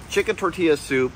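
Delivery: ordinary talk, nothing marked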